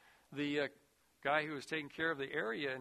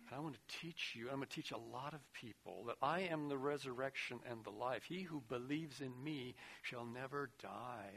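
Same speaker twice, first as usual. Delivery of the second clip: softer, lower, tender voice; gentle Jesus; 4 semitones lower, 10 dB quieter than usual. Retold or imitated. Preacher voicing Jesus comforting the disciples, soft and tender. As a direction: speak it quietly, like a promise.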